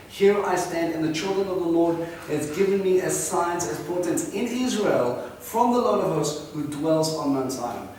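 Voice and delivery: high, loud proclaiming read; preaching scripture. Acted. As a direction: proclaim the verse to the hall. Scripture read at full force.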